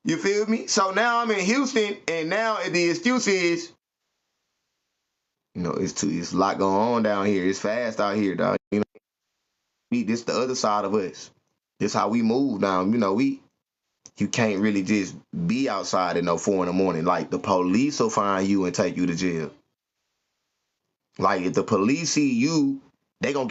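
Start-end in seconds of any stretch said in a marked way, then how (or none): none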